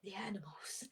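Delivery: breathy paranoid whisper. Acted. Paranoid Richard whispering blame at the animals. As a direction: hiss it like a conspiracy.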